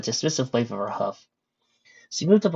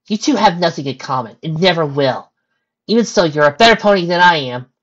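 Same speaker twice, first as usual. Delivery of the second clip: higher, louder pony voice; insistent pony friend; 6 semitones higher, 9 dB louder than usual. Acted. Pony setting her friend straight, raised insistent voice. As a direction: insist firmly in a raised character voice.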